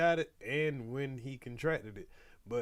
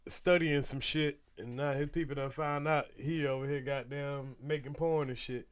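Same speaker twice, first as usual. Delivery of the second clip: ordinary talk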